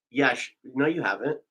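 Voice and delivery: ordinary talk, nothing marked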